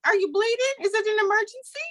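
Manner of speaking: very high, incredulous grilling, imitated; exasperated mom. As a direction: fire mock-urgent questions at an interrupting kid. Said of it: "I'm gonna be like".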